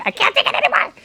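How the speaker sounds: high-pitched mock mom voice; squeaky, hurried mom impression; impatient mom. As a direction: imitate a mother who's had enough. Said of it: "my mom was like".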